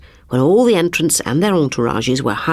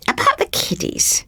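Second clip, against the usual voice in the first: Glad's voice. Acted. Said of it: "said Glad".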